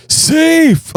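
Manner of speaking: high, stretched-out umpire-style call; imitated yell. Man at the mall door yelling at the sliding kid, imitated yell. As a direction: yell it out high, mimicking the onlooker. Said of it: "he yells out".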